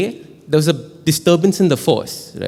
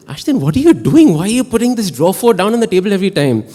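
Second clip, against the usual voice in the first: high, loud, baffled challenge; exasperated questioning; 7 semitones higher, 4 dB louder than usual. Retold or imitated. Fellow player challenging him over the draw four, exasperated imitation. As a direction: hurry through an incredulous complaint, pitched up.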